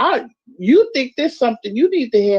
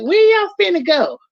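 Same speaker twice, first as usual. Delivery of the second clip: pitched-up mock Southern drawl; imitated Southern drawl; 7 semitones higher than usual. New Yorker who stayed down south too long, mock Southern drawl. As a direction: slip playfully into a Southern accent. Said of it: "that southern drawl".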